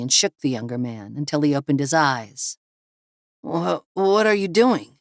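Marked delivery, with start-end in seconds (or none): 3.4-4.9 groggy, higher-pitched protest; just shaken awake and complaining; groaned, halting question; sleepy groan. A narrator's reading. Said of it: "Harvey groaned sleepily".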